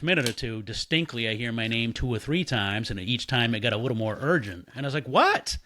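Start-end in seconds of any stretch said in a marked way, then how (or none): 5.1-5.6 loud high yelled what; shouted question. Retold or imitated. Answering the voice calling from the shower, loud yell. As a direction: yell it through the door.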